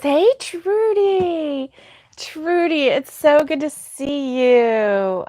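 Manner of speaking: high, drawn-out singsong hello; delighted greeting. Real, not acted. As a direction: greet a long-missed friend, stretching the name. Delighted greeting to arriving viewer.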